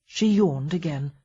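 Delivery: ordinary delivery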